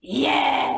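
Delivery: shouted distorted yeah; scream. Shown as a demonstration. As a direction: scream the word out. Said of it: "nos premiers screams".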